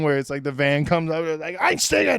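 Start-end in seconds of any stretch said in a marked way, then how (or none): 1.6-2.2 German command shout. Acted. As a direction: yell it like an action-movie command to jump in. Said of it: "we tried to do, like, this A-team thing".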